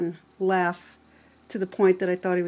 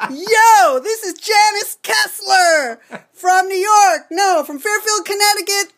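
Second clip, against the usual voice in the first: much higher and louder, hammed-up New York accent, slow and shouted; over-the-top New York accent; 10 semitones higher, 7 dB louder, slower than usual. Guest plays up New York accent for radio host, exaggerated and loud. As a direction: belt it out like a cartoon New Yorker. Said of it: "Get as New York as you possibly can".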